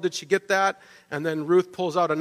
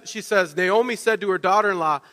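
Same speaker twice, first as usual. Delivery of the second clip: ordinary delivery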